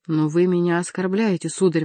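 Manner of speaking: usual speaking voice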